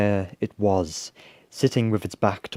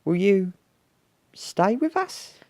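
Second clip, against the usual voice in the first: high, hopeful little voice, slowing; tentative small-creature plea; Basil; 12 semitones higher, 3 dB louder than usual. A narrator's reading. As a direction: ask carefully, climbing very high. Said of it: "Basil paused and took a deep breath".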